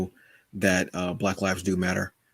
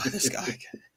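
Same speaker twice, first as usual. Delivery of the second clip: softer, exasperated aside in imitation; imitating annoyed Joker; 5 semitones higher, 6 dB quieter than usual. Host voicing Joker weary of his foe, mock annoyance. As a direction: mutter it like a fed-up villain. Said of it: "He was legit annoyed".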